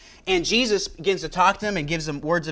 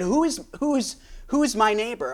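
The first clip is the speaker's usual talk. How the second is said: higher, testing challenge to Jesus; sarcastic lawyer; 7 semitones higher than usual. Acted. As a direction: ask it like you're baiting him. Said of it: "maybe a sarcastic kind of tone".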